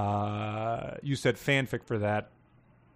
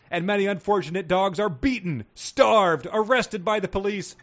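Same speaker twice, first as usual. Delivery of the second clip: high, loud indignant oratory; impassioned speech, pitched up and pushed out; orating dog character; 8 semitones higher, 5 dB louder than usual. A narrator's reading. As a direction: build a list of grievances like a rally speaker. Character's speech read aloud.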